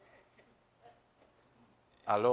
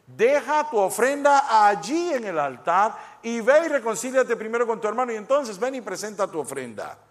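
emphatic reading; 8 semitones higher, 9 dB louder than usual. Shown as a demonstration. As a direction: proclaim the correct verse with force. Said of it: "algunos de ustedes no estaban leyendo".

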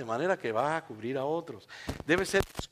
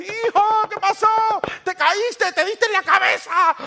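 high, loud frantic questions; panicked mother; 10 dB louder, slower than usual. Acted. Mother at the locked bathroom door to her son, panicked shouting. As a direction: shout in alarm through a door.

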